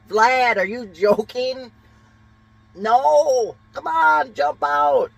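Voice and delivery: very high, loud, slowed calls to a friend; hammed-up frantic high yell to jump out; mock shout at buddy. Partygoer yelling at Vlad in the fog, hammed-up high shout. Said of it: "what would be a good Russian name".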